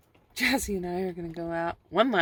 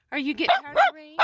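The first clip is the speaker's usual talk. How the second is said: higher and fast coaxing voice for the dog; 8 semitones higher, 4 dB louder, much faster than usual. Real, not acted. Owner teasing her tired dog, high and fast baby talk. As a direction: tease the dog in a sing-song.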